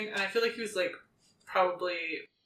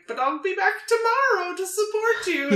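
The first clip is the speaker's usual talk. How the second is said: put-on high, loud sweet old lady; 13 semitones higher, 7 dB louder than usual. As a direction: talk like a sugary old neighbour. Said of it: "I love your old lady voice".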